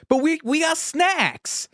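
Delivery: high, slow, pleading Boston whine; Casey Affleck impression. Quoting Casey Affleck in the back seat, high, slow Boston whine. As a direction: whine it like a kid defending the snacks. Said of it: "he just goes".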